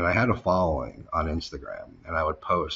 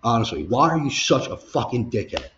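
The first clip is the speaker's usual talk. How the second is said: retold angry outburst, higher and louder; snapped; 6 semitones higher, 6 dB louder than usual. Worker losing it at his manager, snapped. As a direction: re-enact losing it at the boss, voice raised. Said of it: "I just snapped".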